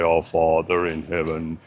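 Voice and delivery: a mock-reverent churchy prayer tone; praying voice. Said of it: "we have our praying voice".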